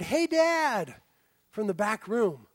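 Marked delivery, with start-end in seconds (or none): 0.0-0.9 imitating child's call. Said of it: "And I heard".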